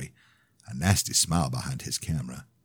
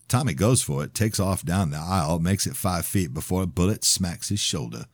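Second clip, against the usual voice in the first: usual speaking voice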